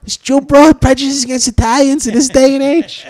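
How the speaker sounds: high, loud mock mobster outrage; Joe Pesci style rant. Mocking the troll as a shouting Pesci-type mobster. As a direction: ham up an offended wise guy. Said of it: "stuck in some Joe Pesci movie".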